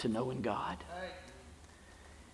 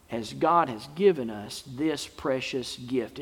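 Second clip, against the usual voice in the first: usual speaking voice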